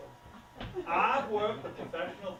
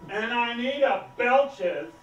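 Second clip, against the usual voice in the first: loud, slow, higher exasperation; exasperated venting; 4 semitones higher, 8 dB louder, slower than usual. Acted. Harried director venting problems to the waitress, exasperated. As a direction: punch it out, drawn out and loud.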